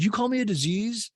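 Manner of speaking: high-pitched teasing comeback; flirty mock offence. Flirting couple trade insults, mock-offended high retort. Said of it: "it's all flirty".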